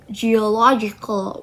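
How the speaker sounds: "geological" with the stress on "lo". Shown as a demonstration. Showing where the stress shifts, "geological" stressed on "lo". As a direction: say "geological", leaning on the third syllable. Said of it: "shift to low".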